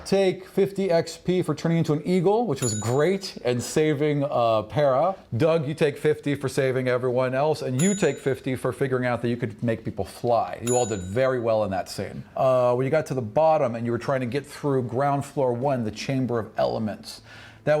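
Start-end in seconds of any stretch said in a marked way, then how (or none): none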